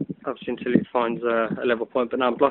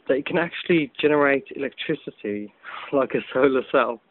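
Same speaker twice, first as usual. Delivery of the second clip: ordinary talk, nothing marked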